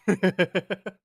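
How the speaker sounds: high, loud burst of laughter; a rapid string of high chuckles; real laugh. Real, not acted. Streamer laughs aloud in chat.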